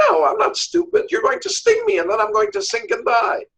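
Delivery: high-pitched storytelling protest; indignant refusal. Acted. Frog turning down the scorpion, high indignant refusal.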